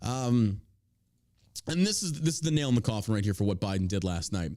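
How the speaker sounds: ordinary talk, nothing marked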